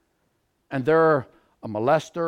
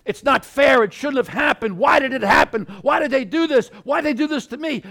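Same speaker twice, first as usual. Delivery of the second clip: high-pitched, rising string of protests; aggrieved protest; 7 semitones higher, 4 dB louder than usual. Acted. Preacher voicing a hurt victim stuck on why, aggrieved. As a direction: plead against the unfairness, louder as it builds.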